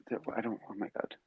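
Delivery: usual speaking voice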